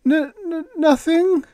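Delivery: high, loud nervous stutter; gulping stammer. A narrator's reading. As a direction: gulp and stammer it out. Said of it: "gulped Todd".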